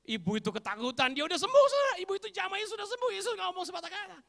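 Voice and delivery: high-pitched, climbing preacher's rush; excited full-force preaching. Acted. Preacher telling how the woman was healed, excited preaching. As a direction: build to a loud, high peak.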